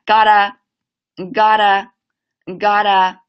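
linked got a drill; linking got a. Shown as a demonstration. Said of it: "连起来".

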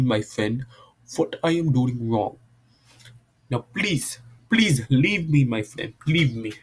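put-on Indian accent, settling nearer own pitch; amateur Indian accent. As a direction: plead to be left alone in an Indian accent. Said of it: "and an Indian accent".

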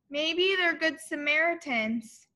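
ordinary delivery

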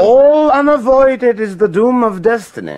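high, loud proclamation of fate; loud declaration. Acted. Richard proclaims fate to the queen, loud. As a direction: declaim it over her.

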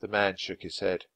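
ordinary delivery

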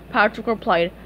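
ordinary delivery, nothing marked

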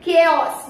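"केओस" repeated firmly with hard k; correct pronunciation. Shown as a demonstration. Teacher answering her own question, correct pronunciation. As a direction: say "केओस" as KAY-os. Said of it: "क्या बोलेंगे".

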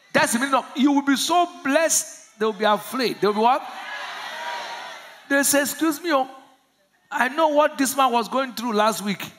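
loud, high excited preaching; full-force preaching. Real, not acted. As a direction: preach it with rising fire.

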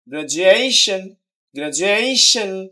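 "Graduation" with stress on য়ে; stress before ending. Shown as a demonstration. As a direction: stretch and hit য়ে in "graduation". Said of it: "শেষের আগেরটা দিতে হবে". ("গ্র্যাজুয়েশন"-এর য়ে-তে জোর)

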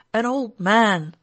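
loud, slow laughing shout. A narrator's reading. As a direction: bellow it as if to a deaf man. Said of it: "laughing and shouting at him as though he were deaf".

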